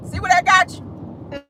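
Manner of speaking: taunting. Acted. Taunting Prince Andrew.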